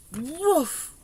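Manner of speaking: high, loud "woof" of blown air; big trumpet-blast puff; voiced puff of blowing. A narrator's reading. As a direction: blow it out in a sudden loud, high whoof.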